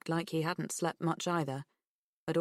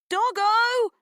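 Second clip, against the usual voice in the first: much louder and higher call; 10 dB louder than usual. A narrator's reading. Girl calling her lost dog again, louder and higher. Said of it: "louder and higher this time".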